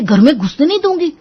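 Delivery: mild mock scolding; mock bossy wife. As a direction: mimic her threat. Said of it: "मुझ पर चढ़कर कहती है".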